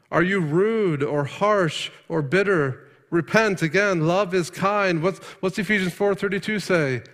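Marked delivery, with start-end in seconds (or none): 0.1-5.0 fiery preaching. Acted. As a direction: urge the congregation hard. Preacher continues.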